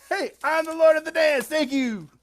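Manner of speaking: high, loud, quick stage boast; showboating dog. Acted. Dog takes a bow after the jig, showboating. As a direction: crow it like a show-off performer.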